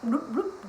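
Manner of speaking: little popped bloop sounds; fish bubble noise. Shown as a demonstration. Teacher makes animal sounds for kiddos, goldfish bloops. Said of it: "I'm going to make animal sounds".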